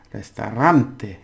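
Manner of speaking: "Restaurante" with a loud, drawn-out RAN; stress on RAN. Shown as a demonstration. Teacher shows the accent on the ran, stress on RAN. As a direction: punch the third syllable of "Restaurante". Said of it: "of the accents on the ran".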